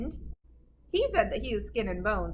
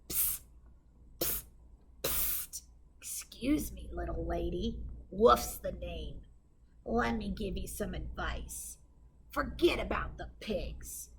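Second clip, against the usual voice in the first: sly low whisper; whispering wolf; 3 semitones lower, 6 dB quieter than usual. Acted. Wolf whispering advice to the witch. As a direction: hiss the secret from the shadows. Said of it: "wolf whispers to Gritch".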